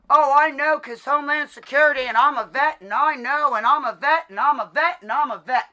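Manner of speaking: sing-song his boast back at him; sneering mock of his bragging; mocking repetition.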